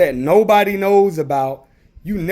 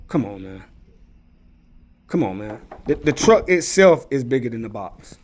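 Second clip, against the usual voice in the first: ordinary delivery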